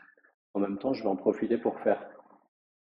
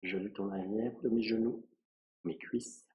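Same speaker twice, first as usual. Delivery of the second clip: soft, unhurried meditation voice with long pauses; guided relaxation; 5 dB quieter than usual. Acted. Yoga teacher guiding a student through a relaxing body scan, softly and calmly. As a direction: lead a quiet body scan, phrase by phrase.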